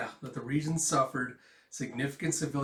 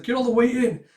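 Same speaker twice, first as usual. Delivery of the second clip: shouting; 11 semitones higher, 12 dB louder than usual. Acted. Crowding into shelter.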